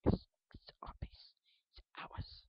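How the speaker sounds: slow, greedy whisper; possessive whisper. Acted. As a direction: hiss it like a hoarder guarding treasure.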